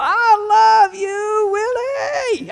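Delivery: high, drawn-out holler to a stage; yelling fan. Retold or imitated. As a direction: imitate a rowdy concertgoer yelling, stretched out and high. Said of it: "She keeps on yelling".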